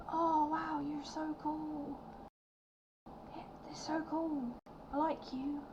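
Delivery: put-on high, soft, slow kid voice; children charmed by the fish lady, high and soft; awed children. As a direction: breathe it out in childlike wonder. Children react to the spell.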